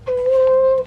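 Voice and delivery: high, loud mock-spooky ooh. Reacts to creepy step.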